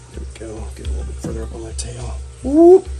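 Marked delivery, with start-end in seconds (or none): 2.4-2.9 startled whoop. Real, not acted. Reacting to snake moving.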